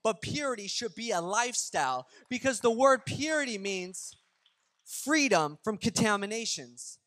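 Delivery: full-force preaching. Real, not acted. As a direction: drive each point home to the congregation. Preaching the main point.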